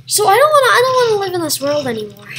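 loud, high outburst, then rushed; hammed-up wail, falling as it goes; mock despair. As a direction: cry out in exaggerated despair.

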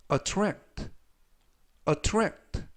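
teacher's demo of attract, uh-TRAKT; model pronunciation. Shown as a demonstration. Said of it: "라고 발음하시면 되겠고요". (attract를 높게, 두 번째 음절에 강세)

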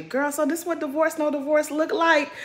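high-pitched mock dig; Giselle. Voices Giselle's imagined dig at Ashley.

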